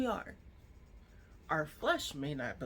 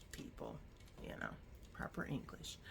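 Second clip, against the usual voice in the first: soft whisper under the breath; a hushed little aside; 12 dB quieter, faster than usual. Real, not acted. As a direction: whisper it as a quick correction. Self-correcting aside.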